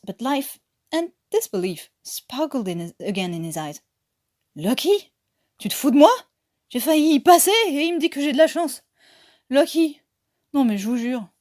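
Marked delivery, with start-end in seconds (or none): 4.6-11.3 indignant outburst. Acted. Lebeau's outraged French outburst after near death.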